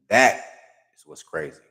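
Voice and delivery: loud "That", hushed rest; slow emphatic. Acted. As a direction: pause after "That" for weight. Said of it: "That's what's crazy".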